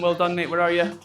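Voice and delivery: ordinary talk, nothing marked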